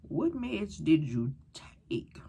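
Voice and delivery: very slow whispered question; slow scared whisper. Retold or imitated. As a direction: whisper it, afraid to ask. Asking fearfully about meds.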